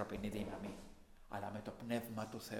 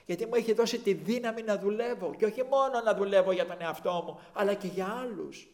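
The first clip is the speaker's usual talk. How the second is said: louder, higher preaching fervour; 8 semitones higher, 9 dB louder than usual. Acted. Preacher telling a beggar about his own change, preaching. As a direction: preach it with rising fire.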